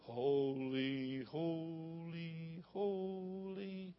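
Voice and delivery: soft, flat, half-hearted holy; mock the weak little worship; timid holy. Shown as a demonstration. Preacher mocking a feeble, soft holy. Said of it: "they didn't just come singing".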